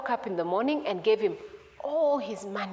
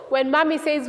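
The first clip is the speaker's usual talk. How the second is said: usual speaking voice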